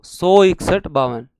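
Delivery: "सौ इकसठ बावन" very slow and loud; drilled for memory. Shown as a demonstration. Teacher drills numbers to memorise, "सौ इकसठ बावन" loud and drawn out. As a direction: hammer out each number in "सौ इकसठ बावन" slowly. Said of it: "याद करना है तुम लोगों को".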